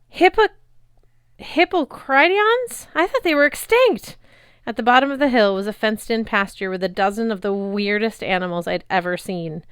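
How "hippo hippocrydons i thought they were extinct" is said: high, louder astonished voice; can't believe her eyes; amazed girl. A narrator's reading. Girl spotting extinct creatures, high astonished voice. Annabeth stunned by animals.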